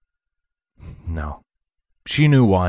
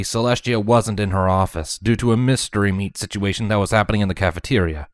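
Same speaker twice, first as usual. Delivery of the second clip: usual speaking voice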